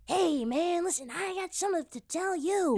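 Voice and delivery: high, raspy Tarantino voice; Tarantino impression. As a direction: do Quentin with a high rasp. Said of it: "do a Quentin impression".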